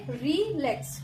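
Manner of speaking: Relax slowly split, री light and lakhs stressed; stress on second syllable. Shown as a demonstration. Teacher showing an iambic word, relax split with weight on lakhs. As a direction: break relax in two, leaning on lakhs. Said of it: "री soft है".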